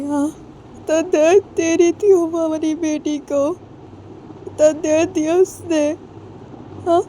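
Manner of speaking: mother weeping over her hurt daughter; tearful anguish. Acted. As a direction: plead through tears for her daughter. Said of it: "Hrithik जब रोते होते हैं".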